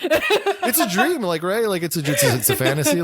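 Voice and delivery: high-pitched, giggly justification; talking over a laugh. Real, not acted. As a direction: protest through real laughter. Joking defence amid shared laughter.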